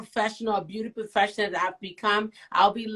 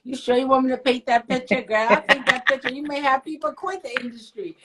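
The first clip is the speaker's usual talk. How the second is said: mock threat; 4 semitones higher, faster than usual. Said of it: "No, I'm just teasing".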